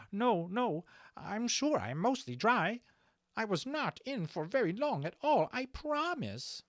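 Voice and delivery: high, anxious, pleading dragon voice; timid small dragon. A narrator's reading. Levitas reassuring his captain, high and timid. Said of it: "hunching himself very small".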